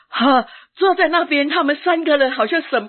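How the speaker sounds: fighting back tears as she recalls it; tearful. Real, not acted. A woman telling the church how the leaders judged her, tearful.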